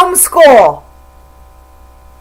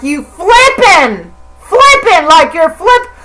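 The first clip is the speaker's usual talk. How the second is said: mock swearing; 6 semitones higher than usual. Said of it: "So now I'm like".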